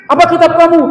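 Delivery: loud, very high, stern questioning; harsh angel question. Retold or imitated. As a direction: fire the question like an interrogating angel. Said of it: "semua dengan nada yang keras". (malaikat bertanya dengan nada keras)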